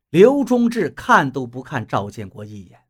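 usual speaking voice

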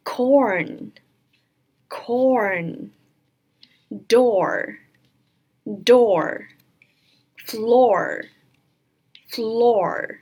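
drawn-out single words, falling at the end; word-reading demo. Shown as a demonstration. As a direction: model each word slowly and clearly. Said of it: "我们来看一下今天的单词". (单词示范朗读，拉长读清)

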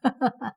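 short, high chuckle; brief laugh after a jibe; teasing laugh. A narrator's reading. Mo Fan laughs after mocking his friend's efforts, teasing chuckle.

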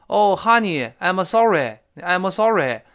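mock Chinese accent, syllable by syllable; Chinese-accented English. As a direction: imitate heavy Chinese-accented English with added vowels. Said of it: "发音像中国话".

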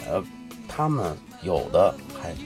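usual speaking voice

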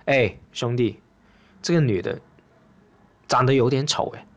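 low, halting, mock-embarrassed remark; awkward. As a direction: break it to a buddy in hesitant pieces. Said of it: "我一看我就很尴尬". (尴尬地低声吞吞吐吐)

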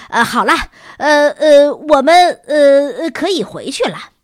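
winded, loud and high, halting on gasped fillers; out of breath. A narrator's reading. Exhausted teacher calling off the run to students, out of breath. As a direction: struggle to get words out between breaths. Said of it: "维茨尔先生气都喘不过来了".

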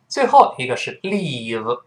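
ordinary talk

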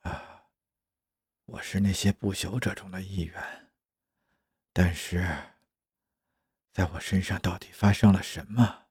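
hushed, low-energy, half-whispered musing; dazed self-questioning. Acted. Confused clone wondering what happened to his body, hushed and dazed. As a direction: murmur it to yourself, bewildered.

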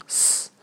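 s said as a bare hissed consonant; 音标读音. Shown as a demonstration. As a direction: sound out "s" alone, no vowel. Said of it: "在sa这个字母上标记s". (单独发出辅音 "s")

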